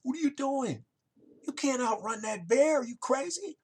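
high, alarmed cry of disbelief; a frantic friend who can't believe his eyes; squeaky, high-pitched panic at a buddy lacing up his shoes; panicked buddy. Retold or imitated. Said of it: "his buddy looks over and says".

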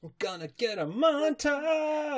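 high, slow drawn cheer; excited cheer. Real, not acted. To co-host, cheering for the montage. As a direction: savor the word montage.

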